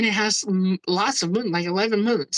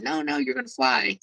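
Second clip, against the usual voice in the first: entity's reassurance; 6 semitones higher than usual. Retold or imitated. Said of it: "he said".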